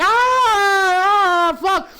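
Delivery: long, high, loud falling-passenger scream; mock terrified scream. Imitating passengers tipping upside down, mock screaming. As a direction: scream as if tipping over, hammed up. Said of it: "They're all just tipping upside down".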